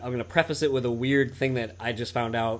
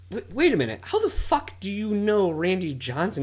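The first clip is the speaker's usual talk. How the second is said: higher, slowed mock shock; retold astonishment; 7 semitones higher than usual. Retelling the stunned question to his friend, mock astonishment.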